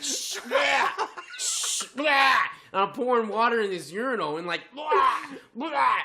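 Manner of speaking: high laughing retching noises; mock gagging. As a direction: gag and laugh through it. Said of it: "I'm like".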